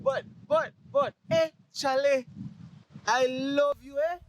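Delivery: playful mock Mexican accent with chale. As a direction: ham up a Mexican accent for laughs. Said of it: "when I do the accents".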